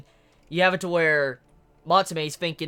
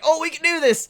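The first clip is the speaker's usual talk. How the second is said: mock confident fodder; 8 semitones higher, 4 dB louder than usual. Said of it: "saying, like".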